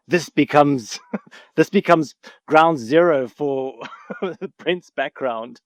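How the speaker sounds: slow, words broken up by real laughter; laughing while talking. Real, not acted. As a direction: laugh your way through the line. Laughing at his own obscurity.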